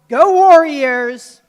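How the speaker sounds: rousing, drawn-out school cheer; loud yell. Shown as a demonstration. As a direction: yell it slow and high to the whole crowd. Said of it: "I hope you all yell often and loud".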